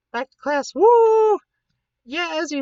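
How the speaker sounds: high, loud excited whoop; cheering woo. Real, not acted. Cheering back to class.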